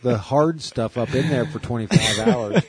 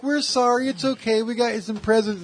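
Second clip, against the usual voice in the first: mock comforting parents; 11 semitones higher than usual. Parents consoling their bawling son, high mock soothing. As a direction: soothe the crying kid in a squeaky voice. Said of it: "They're like".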